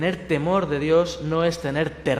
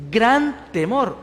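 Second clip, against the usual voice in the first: "gran temor" slow, higher and louder; emphatic; 5 semitones higher, 5 dB louder, much slower than usual. Shown as a demonstration. Preacher underlining the disciples' fear, slow and emphatic. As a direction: lean hard on "gran temor".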